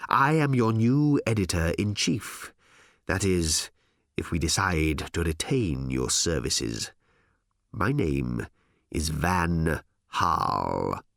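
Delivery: slow, low, self-important executive; smooth new boss. Acted. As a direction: announce yourself with slow, measured authority. New editor introduces himself.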